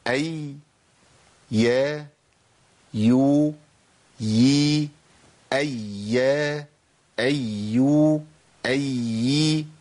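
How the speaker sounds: each syllable أي يا يو يي said clearly and apart; drill of the ya sounds. Shown as a demonstration. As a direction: sound out أي، يا، يو، يي one by one for the class. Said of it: "طيب نقولها بقى". (نطق أي، يا، يو، يي ببطء ووضوح)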